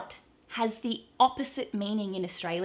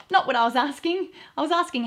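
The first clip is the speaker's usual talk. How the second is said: mock exasperation; 5 semitones higher, 4 dB louder, faster than usual. Real, not acted.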